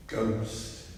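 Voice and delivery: ordinary delivery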